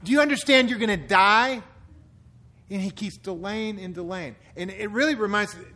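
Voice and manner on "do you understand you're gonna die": warning shout. Retold or imitated.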